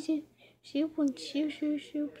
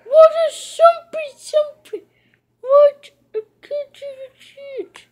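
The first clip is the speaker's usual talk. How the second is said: outraged shout; 12 semitones higher, 11 dB louder than usual. Real, not acted. Outraged at the game.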